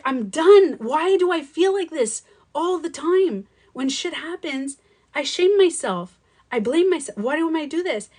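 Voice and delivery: high, drawn-out exasperated plea. Retold or imitated. Woman recounts railing at herself for self-blame, exasperated. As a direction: wail it at yourself, at your limit. Retelling a self-blaming outburst.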